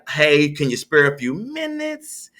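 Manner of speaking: casual caller's pitch, drawn out and rising at the end; sales-call tone. Shown as a demonstration. Cold caller asking for a moment, drawn-out sales-call tone. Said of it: "is your tone important? ... There's a difference".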